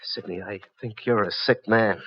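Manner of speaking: soft and slow, almost under breath; quiet cold verdict; quiet, slow accusation. Acted.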